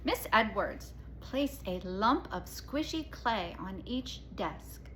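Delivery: usual speaking voice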